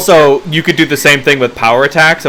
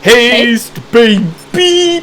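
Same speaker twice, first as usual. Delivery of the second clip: high hyped call; excited cheer; 9 semitones higher, much slower than usual. Real, not acted. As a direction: hype it up. GM cheers haste spell.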